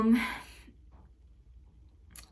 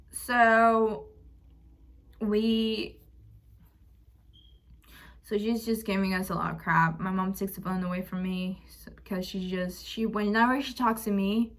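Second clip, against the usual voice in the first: ordinary delivery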